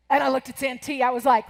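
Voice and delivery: normal talking voice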